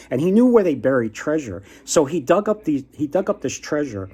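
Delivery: ordinary talk, nothing marked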